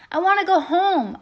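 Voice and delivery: high, loud holler; big yell over the wind; hollered confession. A narrator's reading. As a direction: holler it out into the night. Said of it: "I hollered".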